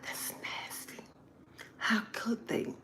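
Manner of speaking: whispered prim disgust. Retold or imitated. Mocking prudes, scandalized whisper. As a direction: whisper it like a scandalized church lady. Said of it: "some people think".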